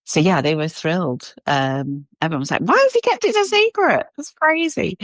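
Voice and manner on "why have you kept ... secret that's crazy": mock shocked coworkers. Coworkers teasing her about the secret, high surprised voice. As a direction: play the stunned coworker, lifted high.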